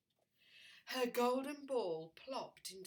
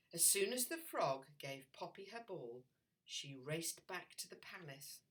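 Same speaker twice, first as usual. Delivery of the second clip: usual speaking voice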